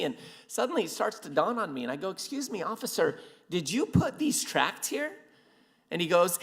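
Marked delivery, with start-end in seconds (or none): 2.1-5.1 hushed, high, tentative question; acted cautious realization; dawning suspicion.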